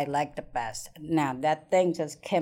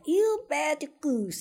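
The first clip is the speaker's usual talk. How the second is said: high, slow, lightly voiced scolding aunt; mock scolding aunt; 10 semitones higher, slower than usual. Aunt scolds the goose she is about to cook, high and slow.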